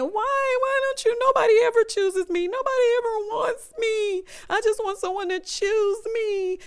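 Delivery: high, sobbing cry of rejection; imitating her sobbing. Quoting Marissa's breakdown on the show, high and tearful. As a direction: voice her heartbreak through tears. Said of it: "Her just saying".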